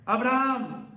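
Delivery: drawn-out loud call of a name; the angel's urgent summons; calling out from heaven. Acted. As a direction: call out to someone far away, stretching the name. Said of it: "le gritó desde el cielo".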